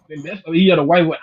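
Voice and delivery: ordinary talk, nothing marked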